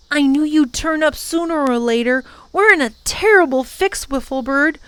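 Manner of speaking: a hint of a young boy's voice; boy speaking to his beloved bird; lightly voiced boy. A narrator's reading. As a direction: voice the boy, earnest and a little worried. Tom voiced by narrator.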